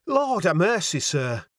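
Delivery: high exclamation from a Yorkshire landlady; a touch of alarm in northern dialect; mildly startled landlady; startled cry. A narrator's reading. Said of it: "she cried".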